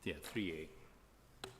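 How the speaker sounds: broad Scottish accent, slow and clipped letters; thick Scottish accent. Real, not acted. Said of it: "It's my thick Scottish accent".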